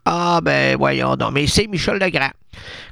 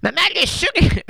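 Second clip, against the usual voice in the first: daughter's high voice; 11 semitones higher than usual. Retold or imitated. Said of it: "Elle dit".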